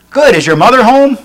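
put-on eager caller questioning a small child; telemarketer on the phone.

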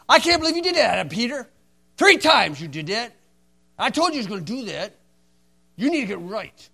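loud, high, fast browbeating; bully him into saying sorry. Retold or imitated. What Jesus did not say to Peter, loud bullying. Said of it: "That's a bully".

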